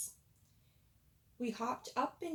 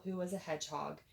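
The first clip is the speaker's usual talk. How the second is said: plain reading voice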